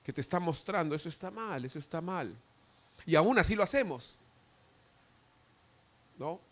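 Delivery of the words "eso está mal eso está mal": soft warning voice of conscience; hushed voice of conscience warning; quiet inner voice. Acted. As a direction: murmur it like a conscience.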